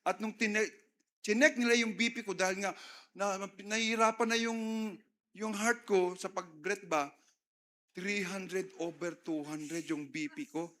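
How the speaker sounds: normal talking voice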